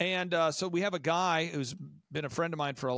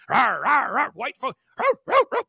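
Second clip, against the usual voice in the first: loud mock dog growl; 8 semitones higher, 11 dB louder than usual. Imitating a wild dog.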